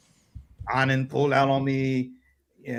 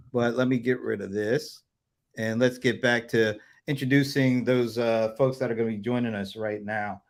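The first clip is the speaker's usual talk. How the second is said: ordinary delivery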